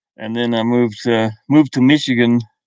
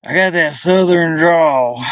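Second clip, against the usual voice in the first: slow, higher, drawn-out Southern voice; Southern drawl; 4 semitones higher, slower than usual. Shown as a demonstration. Streamer showing chat the drawl he still has, in a Southern drawl. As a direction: stretch it like a country drawl. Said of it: "maybe Southern drawl once in a while".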